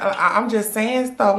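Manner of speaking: ordinary talk, nothing marked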